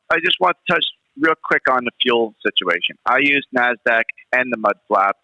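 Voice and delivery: normal talking voice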